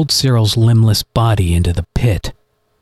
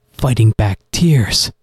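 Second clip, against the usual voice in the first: plain reading voice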